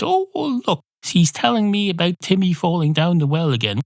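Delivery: high, gushing mock voice; mock adult to puppet. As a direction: ham up talking to a puppet. Mocking people talking to Suhi.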